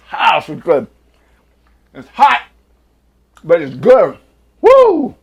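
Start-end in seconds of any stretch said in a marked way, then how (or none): 4.6-5.2 high, loud whoop of delight; excited whoop. Real, not acted. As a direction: whoop at the heat. Reacting to the hot wasabi.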